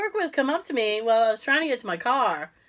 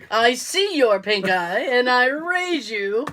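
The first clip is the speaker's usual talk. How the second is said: slow, drawn-out mock challenge; mock poker bet; much slower than usual. Raising co-host's pink eye in a mock bet, slow and deliberate.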